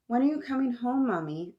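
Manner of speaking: put-on high, quick child's question; little girl pleading on the phone; child asking. A narrator's reading. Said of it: "I asked".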